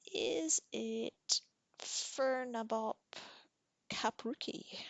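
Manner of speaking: a high, slow whispered guess; sly guess. Acted. Tanith tricking the troll with a silly guess, whispered high and slow. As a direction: feign puzzlement, drawing the sounds out.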